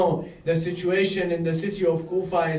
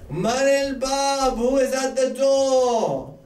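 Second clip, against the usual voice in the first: high, drawn-out call; 6 semitones higher, slower than usual. Acted. Habib calls to whoever knocked, calling out. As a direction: call slowly through a closed door, high.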